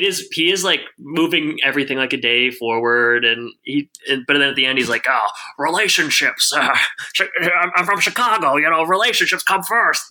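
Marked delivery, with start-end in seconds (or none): 5.1-10.0 hammed-up Chicago accent for a demanding boss; lightly put-on Chicago voice, pitched up; mock Chicago accent. Podcast host imitating the boss Mike in a mock Chicago accent. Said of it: "my impression of".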